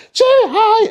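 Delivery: very high, piercing singsong; overdone drama student. Acted. Drama student elves greeting, hammed-up shriek. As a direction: belt it out with stagey cheer. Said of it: "fucking drama students".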